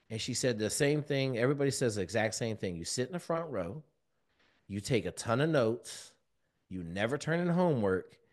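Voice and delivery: usual speaking voice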